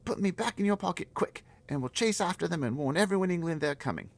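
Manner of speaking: put-on a small girl pleading to stop the giants; high girl's voice. Girl begging the giant, high and loud.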